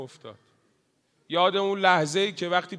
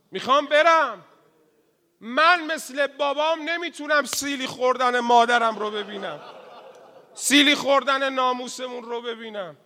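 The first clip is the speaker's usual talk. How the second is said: loud, high, begging to be allowed; pleading insistence; 7 semitones higher, 7 dB louder than usual. Acted. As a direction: insist through a rising cry. (التماس بلند و پراصرار)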